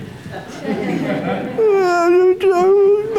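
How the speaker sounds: whiny laugh-cry. Retold or imitated. Mocking whiny sick man.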